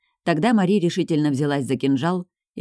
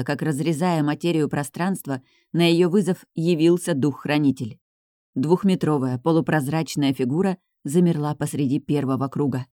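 plain reading voice

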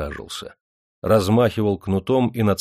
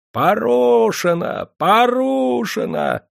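very high, slow, drawn-out louder cry; frenzied shout; 5 dB louder, much slower than usual. Acted. Drunk matchmakers shout at the shamed bride, frenzied and high. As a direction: wail it out in a frenzy. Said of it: "иступлённо закричали охмелевшие свахи".

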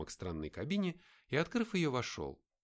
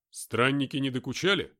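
louder, higher blunt soldier; a hint of a gruff fighter; lightly voiced tough soldier; 4 semitones higher, 10 dB louder than usual. Mark asks Nick.

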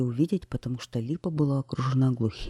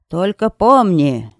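slow, much louder call after her; shouting after; 4 semitones higher, 10 dB louder, much slower than usual. Acted. Witch shouting a warning after the departing queen. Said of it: "крикнула ей вслед колдунья".

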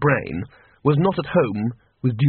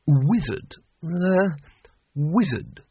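thoughtful; 2 semitones higher, much slower than usual. A narrator's reading. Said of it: "said Ridcully thoughtfully".

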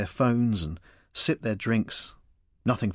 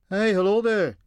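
put-on higher, louder Scottish-accented greeting; mimicked Scottish accent; 8 semitones higher, 5 dB louder than usual. A narrator's reading. Michael answering the passing walker, mimicked Scottish accent. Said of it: "Michael replied in the same voice".

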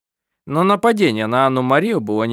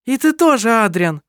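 high, loud aggressive jab; loud high accusing call; aggressive accusation; 9 semitones higher, 5 dB louder than usual. A narrator's reading. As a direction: turn on Adrian. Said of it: "агрессивно сказала Керстин".

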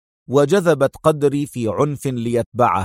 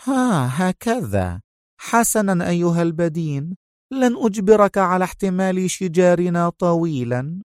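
higher voiced, coolly scornful; cold dismissal; 6 semitones higher than usual. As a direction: brush him off icily. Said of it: "التفتت سونيا إليه في برود وقالت". (ببرود)

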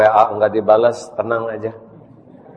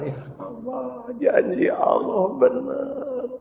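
tearful awe; 14 semitones higher, 4 dB quieter, slower than usual. Acted. Father and son embrace.

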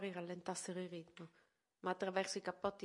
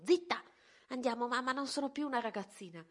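acuto e forte; esclamazione concitata; 5 semitones higher, 6 dB louder than usual. A narrator's reading. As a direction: protesta con slancio. Madre zittisce, figlia protesta. (figlia che protesta con la madre, acuta)

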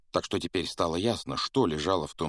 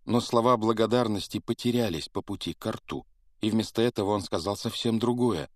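ordinary delivery

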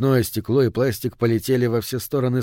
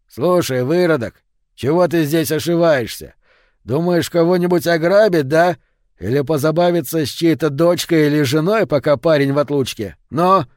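loud, high angry grilling; aggressive challenge; 4 semitones higher, 5 dB louder than usual. A narrator's reading. Local man confronts a suspicious stranger, angry challenge. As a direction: fire accusing questions, raised and forceful.

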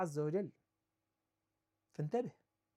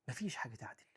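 whispered aside; 7 semitones lower, 11 dB quieter, faster than usual. Real, not acted. Preacher whispering that nothing equals a father.

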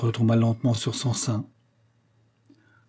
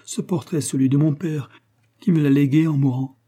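frantic plea, much higher and louder; desperate cry; 5 semitones higher, 3 dB louder than usual. Acted. Sick mother cries out to the bailiff, higher and louder. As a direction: cry out begging him to stop. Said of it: "s'écria la malade".

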